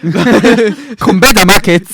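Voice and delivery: put-on high, loud, slow African accent; mock African accent. Parodying meerkat advert, loud mock African accent. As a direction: stretch the slogan in an African accent. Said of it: "like an African person".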